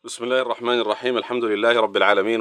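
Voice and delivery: usual speaking voice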